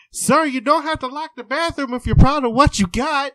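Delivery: high, loud brazen granny; cheeky old lady. Retold or imitated. Patient calling to the man behind the locked door, loud granny voice. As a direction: call through the door boldly. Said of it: "try to turn the doorknob".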